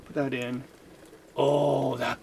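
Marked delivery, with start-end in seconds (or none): none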